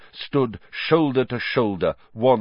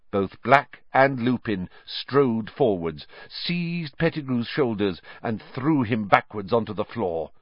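usual speaking voice

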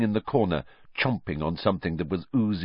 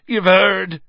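loud, much higher anguished bellow; 11 semitones higher, 9 dB louder than usual. Acted. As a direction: burst out with it at full voice. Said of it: "he bellowed".

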